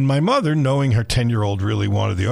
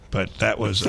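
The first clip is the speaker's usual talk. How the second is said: normal talking voice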